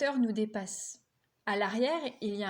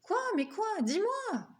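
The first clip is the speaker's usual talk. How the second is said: insistent, rising higher and higher; pestering; 11 semitones higher than usual. Acted. Little brother pestering big brother for news, rising impatient pleas. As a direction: badger him like an eager puppy. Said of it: "comme un petit chien en répétant".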